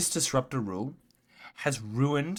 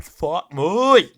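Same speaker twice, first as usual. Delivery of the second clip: slow, louder, higher outburst; drawn-out exasperated curse; 5 semitones higher, 12 dB louder than usual. Real, not acted. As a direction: let it out slowly in frustration. Ranting at commentator.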